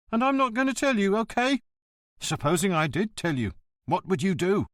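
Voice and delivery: high, prissy voice of a self-important official; a petty bureaucrat standing on his dignity; pompous postmaster. Acted. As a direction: lecture him from behind the counter. The postmaster refusing.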